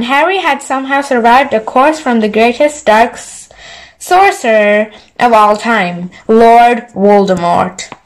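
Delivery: ordinary delivery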